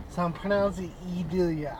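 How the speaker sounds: "Edilia" with first vowel as in "eat"; pronounced ee-DIL-ya. Shown as a demonstration. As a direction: say "Edilia" opening with a long "ee" sound.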